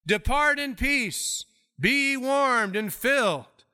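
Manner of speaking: high, slow, sugary send-off; mock pious blessing. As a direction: bless them off grandly without help. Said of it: "someone comes up and says".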